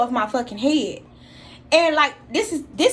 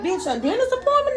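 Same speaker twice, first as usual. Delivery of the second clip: high-pitched retold disbelief; wigging out; 11 semitones higher than usual. Dreamer waking tied down at the dentist, wigging out. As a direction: play it thrown and incredulous, voice jumping up. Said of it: "wigging out".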